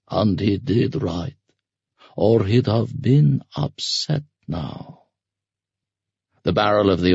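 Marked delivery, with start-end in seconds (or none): 0.1-5.0 slow, stretched-out scarecrow voice; drawling ferryman. Acted. Masked ferryman judging her dead father, mocking drawl. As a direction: linger on each phrase with mild mockery.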